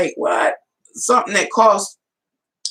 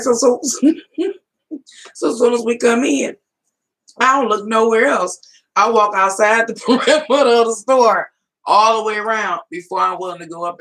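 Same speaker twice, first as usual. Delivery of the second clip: excited explanation; 6 semitones higher, 3 dB louder than usual. Retold or imitated. Excited retelling of store lap.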